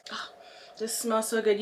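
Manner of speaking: whispering. Real, not acted. Sniffing lotion, unvoiced.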